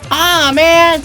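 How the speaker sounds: loud, high-pitched whine of dismay; dismayed cry. A narrator's reading. Harold falls toward the dandelion's jaws, dismayed cry. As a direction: yell out in exasperation. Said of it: "cried Harold".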